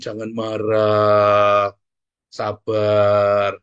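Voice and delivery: long, droning, loud vowels on one note; droned, drawn-out mock soothing; mock calming. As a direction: stretch each word out like an overdone plea for patience. Mimicking unhelpful advice to calm down.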